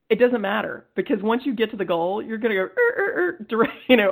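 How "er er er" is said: high imitated brake screech; screeching halt noise. Player stopped dead at the goal, imitated screeching halt. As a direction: squeal out short bursts like brakes. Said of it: "abrupt halt".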